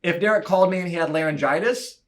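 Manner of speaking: ordinary talk, nothing marked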